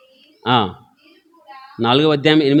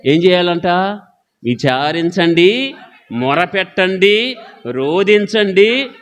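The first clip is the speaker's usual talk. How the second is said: fervent preaching; 3 semitones higher than usual. Acted. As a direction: drag each command out like an altar call. Preacher urging repentance. (నెమ్మదిగా, గట్టిగా వేడుకునే ప్రసంగ శైలి)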